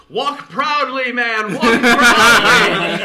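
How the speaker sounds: slow, booming, high-pitched cheer; rousing shout. Acted. Jacques cheering on a batter with four balls, slow rousing shout. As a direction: stretch each word into a grand holler.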